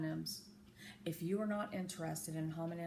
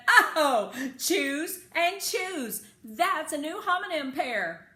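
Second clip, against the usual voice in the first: much louder, higher, laughing delight; excited outburst; 8 semitones higher, 12 dB louder, slower than usual. A narrator's reading. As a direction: burst out with joy over a discovery. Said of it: "I jumped out of my chair and said".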